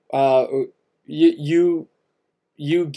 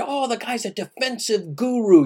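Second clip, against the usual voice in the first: higher-pitched imitation of gushing fans; mock happy fans; 6 semitones higher, 3 dB quieter than usual. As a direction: parrot the admirers in a lifted voice. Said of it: "people that were happy about it".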